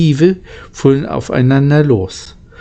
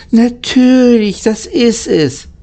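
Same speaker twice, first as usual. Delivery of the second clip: much higher, slow and eager; like a light just switched on in his head; excited discovery, pitched way up; sudden realization; 10 semitones higher than usual. Acted. Lukas suddenly understands the mirage.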